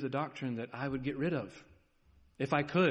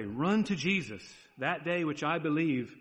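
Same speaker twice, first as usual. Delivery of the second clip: ordinary talk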